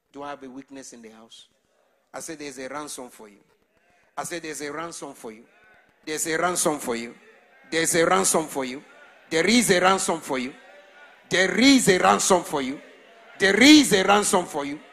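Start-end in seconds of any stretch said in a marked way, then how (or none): 6.0-14.8 from strong to shouted, much louder; building preaching shout. Real, not acted. Preacher shouting to the church, louder each time.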